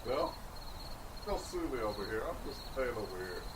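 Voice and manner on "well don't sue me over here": usual speaking voice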